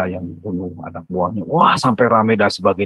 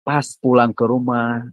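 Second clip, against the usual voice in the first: ordinary talk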